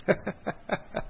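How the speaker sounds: breathy unvoiced chuckle; a quiet pleased laugh; soft laugh. Real, not acted. Monk chuckling as the wife reports her husband well.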